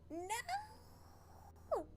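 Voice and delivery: high drawn-out "no!"; dismayed cry. Real, not acted. Streamer seeing a stream warning, dismayed cry. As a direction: wail in dismay, pitch shooting up.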